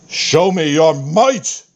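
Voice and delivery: the god's final thundering call; booming climactic challenge. Acted. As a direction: build the dare to a proud peak.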